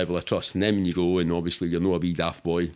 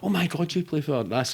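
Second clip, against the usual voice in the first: starstruck fan; 7 semitones higher than usual. Retold or imitated. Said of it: "it's never been".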